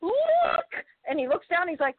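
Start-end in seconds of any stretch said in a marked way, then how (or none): 0.0-0.7 very high, drawn-out cry of alarm; retold panicked cry. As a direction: act out a frantic call to look. Retold panic over bloody footprints.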